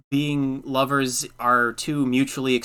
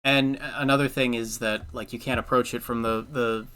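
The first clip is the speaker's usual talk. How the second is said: ordinary talk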